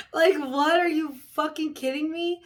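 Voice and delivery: high, loud disbelief; incredulous outburst. Real, not acted. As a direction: burst out in shocked disbelief. Disbelief at the news story.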